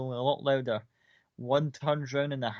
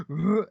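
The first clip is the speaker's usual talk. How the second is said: bear growl; 5 semitones higher, 6 dB louder than usual. Acted. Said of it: "It's like".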